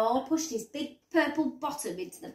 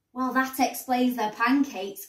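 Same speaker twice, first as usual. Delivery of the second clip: ordinary delivery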